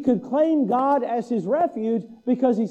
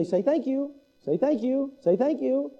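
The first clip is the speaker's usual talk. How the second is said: coaxing parent; 4 semitones higher than usual. Retold or imitated. Parent repeating to drooling baby.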